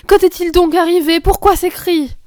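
loud, high questions of alarm; crying out. A narrator's reading. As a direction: demand answers as a frightened mother. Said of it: "s'écria madame de Rosebourg".

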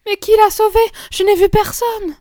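high, loud protest; agitated child's cry; distraught child. A narrator's reading. Distraught child doubts the rescue.

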